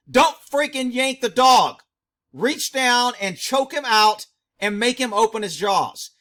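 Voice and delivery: loud, forceful order to a handler; retold yell. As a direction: re-enact chewing out the handler, loudly. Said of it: "I yelled at him and said".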